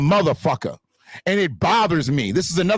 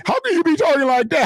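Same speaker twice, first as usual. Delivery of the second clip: high mock voice, much higher; mocking the critics; 12 semitones higher, faster than usual. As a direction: parrot the critics' question in a high sneering tone. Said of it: "Y'all motherfuckers can talk".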